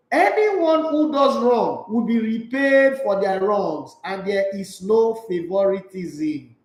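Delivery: "Anyone who does wrong" read slow and stately, every word spaced out; slow formal reading. Shown as a demonstration. Preacher reading English Bible verse to viewers, slow and formal. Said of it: "The English version says".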